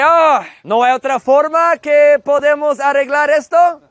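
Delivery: acted innocent asking; coaxing appeal. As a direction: sweet-talk your way out of trouble. Negotiating a fine with police.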